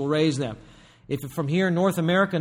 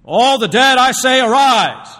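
a deluded man booming over graves, higher and louder; mock grand command; 9 semitones higher, 12 dB louder, slower than usual. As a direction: proclaim it like a would-be god, slow and huge. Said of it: "And you say".

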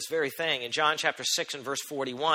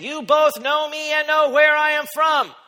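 slow, loud proclaiming shout, higher; cried out; 10 semitones higher, 8 dB louder, slower than usual. Acted. Jesus crying out to the crowd in the temple, loud slow proclamation. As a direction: call it out across the whole temple. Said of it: "Jesus cried out in the temple".

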